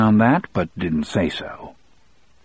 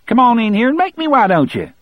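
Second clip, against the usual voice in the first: high, fast, gleeful goading; happy taunt; 5 dB louder, faster than usual. A narrator's reading. Prisoner daring the guard to come into his cell, happily taunting. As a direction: egg him on in a loud, high-pitched rush. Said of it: "he said happily".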